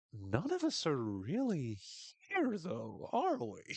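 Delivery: very slow, dazed and spaced out; imitating a tripping Trav; tripping guy. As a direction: drift through it like you're high. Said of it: "so good as tripping Trav".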